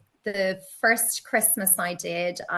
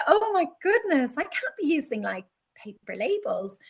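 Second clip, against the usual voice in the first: shocked realisation; 7 semitones higher than usual. Retold or imitated. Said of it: "And I'm like".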